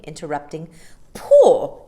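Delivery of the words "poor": loud scornful word; contemptuous. A narrator's reading. Mary Poppins scornful about Mrs Brill's cooking. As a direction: scoff at the word.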